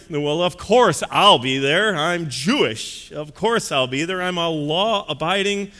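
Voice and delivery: slow, drawn-out pompous drawl; smug well-to-do man sure of his invitation; presuming rich guest. Acted. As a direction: stretch the words with haughty self-assurance. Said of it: "this man kind of was presuming".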